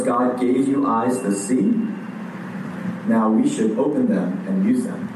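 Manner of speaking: usual speaking voice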